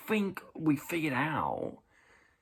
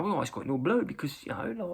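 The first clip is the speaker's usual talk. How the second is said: ordinary talk, nothing marked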